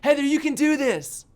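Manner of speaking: high, loud shout up from below; yelled encouragement. Retold or imitated. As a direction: yell to cheer on a scared friend far off. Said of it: "I'm yelling".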